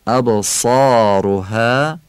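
"ابصارها" slowly, with a full, heavy Arabic saad; Arabic accent. Shown as a demonstration. Teacher shows the correct tone, Arabic accent. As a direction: say "ابصارها" in drawn-out Arabic articulation.

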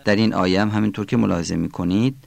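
ordinary talk, nothing marked

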